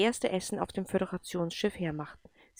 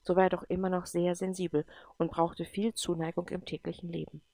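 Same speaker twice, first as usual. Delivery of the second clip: ordinary delivery, nothing marked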